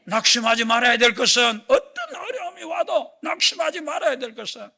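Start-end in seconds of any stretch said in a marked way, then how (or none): 1.7-4.7 higher, louder shouted repeat; preaching shout. Shown as a demonstration. The preacher urges the congregation not to despair, in a rising shout. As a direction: drive it home with a cry. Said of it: "repeated louder".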